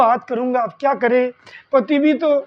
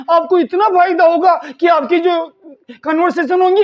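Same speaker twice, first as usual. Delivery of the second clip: ordinary talk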